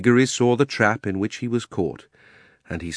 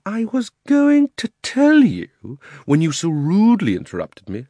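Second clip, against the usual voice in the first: high, loud, drawn-out annoyance; affronted Uncle Andrew; 11 semitones higher, 6 dB louder than usual. Acted. Uncle rebukes Diggory for interrupting, affronted. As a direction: stretch it out huffily, pitched high.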